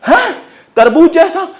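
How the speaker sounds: high astonished question; mock disbelief. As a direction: act out a student's shock.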